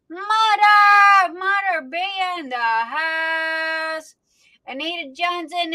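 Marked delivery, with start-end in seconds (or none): 0.1-4.2 high, loud call, then a long drawn-out finish; excited hail. Real, not acted. Host cheering a favorite viewer's arrival, drawn out. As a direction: hail her like she just walked in.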